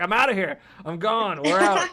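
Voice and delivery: loud hammed-up getaway announcement; mock hasty exit. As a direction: playfully call out an abrupt departure. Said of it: "She was like".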